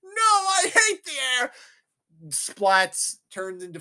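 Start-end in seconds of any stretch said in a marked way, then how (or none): 0.0-1.5 very high, loud comic cry; mock villain wail. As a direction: cry out like a cartoon villain falling. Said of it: "He's like".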